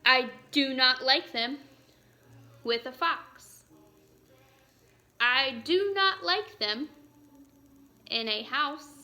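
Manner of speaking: measured storybook read-aloud, long pause after each line; reading aloud to children. Acted. Teacher reads Green Eggs and Ham while the class does burpees, storybook read-aloud. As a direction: read it out like a picture book for the class.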